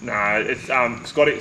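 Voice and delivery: usual speaking voice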